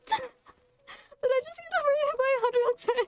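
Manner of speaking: real high-pitched, overwhelmed squeal; flustered shock. Real, not acted. Streamer greeting a sudden crowd of raiders, flustered shock. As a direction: greet them in breathless high disbelief, half laughing. Streamer startled by surprise raid.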